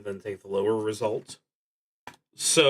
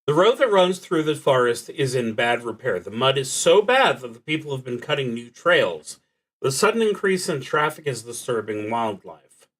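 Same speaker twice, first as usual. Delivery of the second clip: reading the prompt aloud, louder and fuller; card prompt reading; 4 semitones higher, 7 dB louder, faster than usual. Acted. Player reading the card's event to the listener, narrator style.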